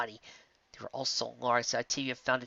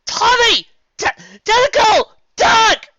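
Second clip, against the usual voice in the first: high, loud urgent yell; loud commanding shout; 16 dB louder, much slower than usual. A narrator's reading. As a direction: yell at them to run.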